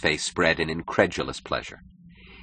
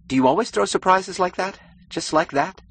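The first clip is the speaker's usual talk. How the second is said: much higher, blurted in surprise; 4 dB louder than usual. A narrator's reading. Keating to Wynand, blurting out his surprise, much higher. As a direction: let it slip out before you can stop it. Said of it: "He blurted out involuntarily".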